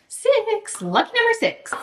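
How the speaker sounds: high, bright count-off landing on six; excited. Real, not acted. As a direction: cheer the lucky number. Drawing prompts from jar.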